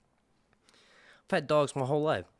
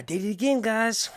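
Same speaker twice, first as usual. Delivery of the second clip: excited; 11 semitones higher, 7 dB louder than usual. Real, not acted. Excited reveal to chat.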